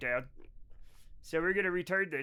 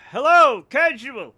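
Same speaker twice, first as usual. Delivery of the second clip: loud greeting; 4 semitones higher, 12 dB louder, slower than usual. Real, not acted.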